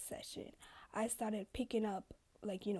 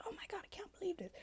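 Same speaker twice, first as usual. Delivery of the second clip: fast, higher mock gasp of outrage; 11 semitones higher, 4 dB quieter, much faster than usual. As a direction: whisper it like a shocked gossip. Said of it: "this is everybody else".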